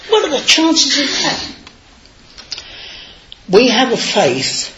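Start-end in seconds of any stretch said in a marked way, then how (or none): 0.1-1.4 high, laughing astonishment; imitating colleagues marvelling at the coincidence; amazed coworkers. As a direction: mimic the office crowd, amazed. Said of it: "And they all go".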